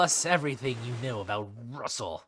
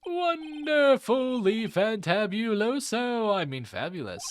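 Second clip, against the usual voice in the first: flamboyant showman; 8 semitones higher, 5 dB louder than usual. Acted. Flamboyant Max character voice.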